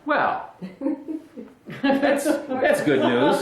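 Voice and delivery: high, slow, laughing sarcasm; sarcastic laughing. Real, not acted. Pastor mocks the scary verse, slow laughing sarcasm. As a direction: let the irony drip through a laugh. Sarcastic reaction to devouring fire.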